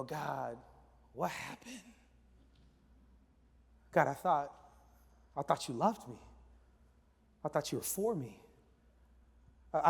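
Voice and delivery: soft, low pleading; hurt prayer. Acted. Believer asking God why he was abandoned, quietly hurt. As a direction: ask softly, let down by someone trusted.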